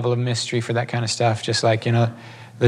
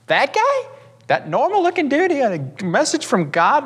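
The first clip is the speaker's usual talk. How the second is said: very high, disbelieving mock question; imitating a stunned woman, pitched way up; incredulous wife; 14 semitones higher than usual. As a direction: squeak it out in exaggerated disbelief. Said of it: "Lady turns around, looks at him like".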